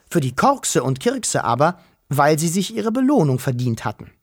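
ordinary delivery, nothing marked

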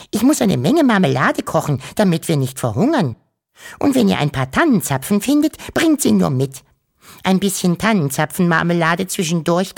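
hoch und lauter, geschäftige Mutter; eifrige Mutter. Acted. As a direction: treib die Kinder munter an. Mother voiced, sends children out. (Mutter schickt Wuschel und Wischel eifrig in den Wald)

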